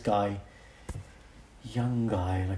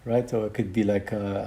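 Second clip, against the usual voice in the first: usual speaking voice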